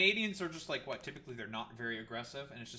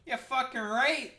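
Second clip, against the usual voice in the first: loud, much higher, hammed-up Canadian accent; a riled-up Canadian hockey fan; aggressive Canadian; 11 semitones higher, 11 dB louder, slower than usual. Streamer jokingly goes full Canadian at the factory, loud Canadian accent. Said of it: "That was aggressively Canadian".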